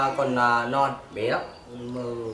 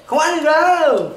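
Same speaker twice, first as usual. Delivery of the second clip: surprised; 14 dB louder than usual. Real, not acted. Surprised it tastes good.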